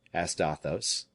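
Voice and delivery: ordinary delivery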